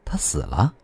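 low, hushed disbelief; stunned. A narrator's reading. Man hears of a death, stunned. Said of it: "梁永胜显然十分吃惊".

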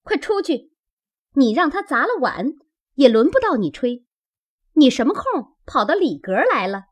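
high, quick scolding from the maid; urgent scolding call. A narrator's reading. Maid chases the old woman from the room, scolding urgently. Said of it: "晴雯忙喊道".

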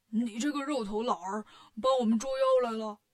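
put-on pig-demon voice hailing an old acquaintance; teasing character greeting; Bajie's brash voice. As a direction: play a cheeky pig demon, building in volume. Bajie hails the old immortal.

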